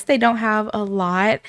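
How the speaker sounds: ordinary delivery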